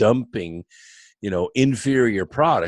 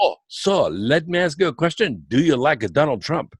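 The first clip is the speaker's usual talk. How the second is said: put-on higher, lilting Italian-accented voice; Italian manager; 6 semitones higher than usual. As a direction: imitate a curious Italian hotel manager. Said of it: "the night manager is Italian".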